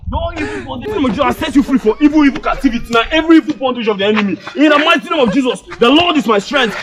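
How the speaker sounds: fervent preacher casting out bondage; deliverance prayer. Acted. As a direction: pray it over him like a deliverance pastor. Skit pastor deliverance prayer.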